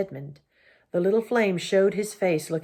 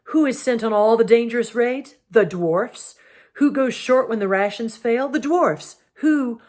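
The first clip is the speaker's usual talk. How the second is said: indignant tirade; 5 semitones higher, 5 dB louder than usual. A narrator's reading. Dwarf's bitter argument, cut off.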